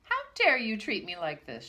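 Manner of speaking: loud, indignant shout; angry shout. Acted. Teacher shouts furiously at Pixie. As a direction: demand it furiously. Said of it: "shouted Mister Grimm".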